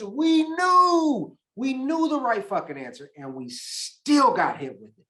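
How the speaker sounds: high, exasperated "We knew"; frustrated outburst. Real, not acted. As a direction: vent the frustration, pitch jumping up. Frustrated about recent failures.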